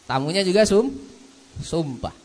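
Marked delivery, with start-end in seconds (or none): none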